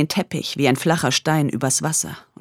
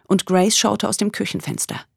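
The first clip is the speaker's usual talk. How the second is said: plain reading voice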